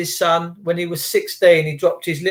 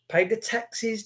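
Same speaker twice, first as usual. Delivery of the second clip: ordinary talk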